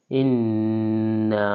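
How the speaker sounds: ইন্না with a long nasal hum on the doubled ন; with ghunnah. Shown as a demonstration. Teacher demonstrating ghunnah on ইন্না. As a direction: hold the ন of ইন্না through the nose. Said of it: "এখানে গুণনা করতে হবে".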